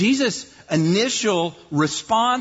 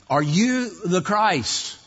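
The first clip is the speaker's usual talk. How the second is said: slow and a little higher, weighing each word; slow pointed question; 4 semitones higher, slower than usual. Retold or imitated. Preacher voicing the leaders' question to Jesus, slow and pointed.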